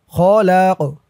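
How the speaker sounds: q released with a bounce; qalqalah push. Shown as a demonstration. Teacher showing qalqalah, final q bounced. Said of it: "ধাক্কা দিয়ে পড়তে হবে".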